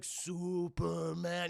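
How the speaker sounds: very slow, stretched-out echo; childlike giant robot. Retold or imitated. Iron Giant repeating Hogarth's word, slow and childlike. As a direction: draw the name out in wonder.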